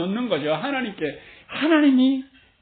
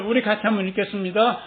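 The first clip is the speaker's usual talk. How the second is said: ordinary talk, nothing marked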